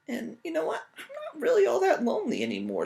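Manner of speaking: put-on high, fast little old lady. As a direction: chirp it as the old woman. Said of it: "said the little old lady".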